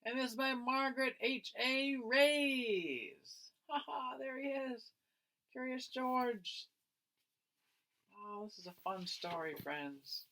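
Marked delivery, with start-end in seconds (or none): none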